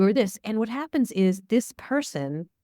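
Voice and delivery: normal talking voice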